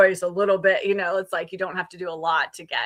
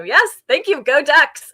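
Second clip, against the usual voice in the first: higher, louder rooting cheer; team cheer; 7 semitones higher, 7 dB louder than usual. Real, not acted. Alumna rooting for her college team, upbeat cheer. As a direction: cheer on your team, breaking into a laugh.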